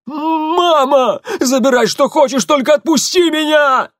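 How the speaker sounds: высокий протяжный крик от боли; молит, срываясь на вопль; испуганный крик. Acted. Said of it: "испуганно закричал Тамир". (испуганно кричит)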